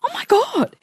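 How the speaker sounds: very high, thrilled child imitation; excited son. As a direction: mimic an amazed, excited little boy. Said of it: "He was that excited".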